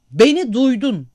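put-on high, loud yell at the drunk's friend; furious shout. A narrator's reading. Angry girl yelling at the drunk man's friend in the restaurant, loud shout. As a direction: scream it so the whole room hears. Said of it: "diye bas bas bağırdı kız".

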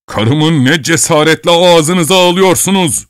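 high, loud, slow outrage; outraged old man. A narrator's reading. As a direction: bellow at the intruder. (kızgın koca, yüksek sesle)

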